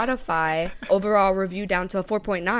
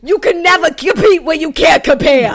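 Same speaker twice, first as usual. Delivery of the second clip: sassy diss; 6 semitones higher, 10 dB louder than usual. Pretend. Diss line to the hater.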